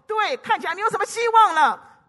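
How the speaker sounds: high, loud taunt of hopelessness; mocking demon, loudly discouraging; devil's voice. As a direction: jeer loudly as the devil, pitch pushed way up. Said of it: "鬼怎么说？".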